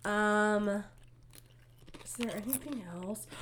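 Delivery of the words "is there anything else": breathy whispered question; whispering. Real, not acted. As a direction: drop to a whisper. Whispered question during podcast.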